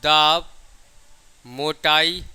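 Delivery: ordinary delivery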